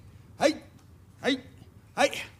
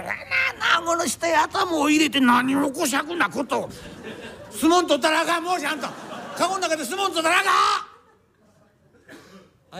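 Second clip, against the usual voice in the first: shouting in a tussle; 6 semitones higher than usual. Acted. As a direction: yell while wrestling in a cramped box. Sumo tussle inside palanquin.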